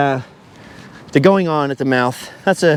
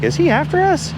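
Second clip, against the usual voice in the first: high, squeaky mock worry; hammed-up high nervous question; mock worried onlooker; 13 semitones higher than usual. Voicing people wondering about him.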